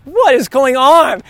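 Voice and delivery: playing her stunned confusion, stretched out; baffled surprise. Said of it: "she's just looking at me like".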